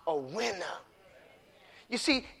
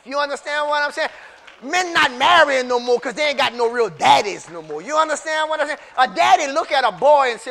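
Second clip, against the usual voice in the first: raised, driving preacher's cadence; preaching; 4 semitones higher, 8 dB louder than usual. Acted. Preacher to congregation, raised and driving. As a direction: preach it hard to the church.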